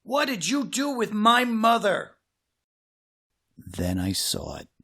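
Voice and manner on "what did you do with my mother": demanding. Acted. Confronting the monster.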